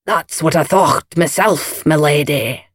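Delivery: lightly Scottish, satisfied deference; a hint of a victorious gardener; Scots head gardener. A narrator's reading. Gardener having won over Lady Coote, drily deferential.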